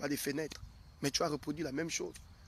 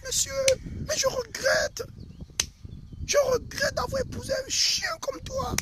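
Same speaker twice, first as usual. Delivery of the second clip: very high mock-wife outburst, building louder; exasperated wife; 4 dB louder than usual. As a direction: play a fed-up wife, shrieking up high and stretching words. Acts wife insulting her husband.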